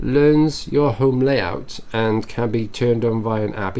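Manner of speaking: slow, pointed reread of learns your home layout; slow sceptical reread. Shown as a demonstration. Reading a Dyson headline aloud, slowly and sceptically. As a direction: drag it out as if it's obvious. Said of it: "Isn't that what most robot vacuum cleaners do?".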